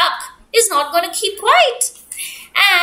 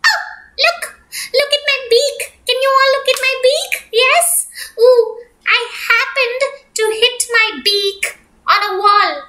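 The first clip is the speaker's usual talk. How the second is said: high, fast, chirpy duck voice; 7 semitones higher than usual. Acted. Duck begging bear for cookie things, high chirpy pleading. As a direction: bounce it up high like a giddy little duck.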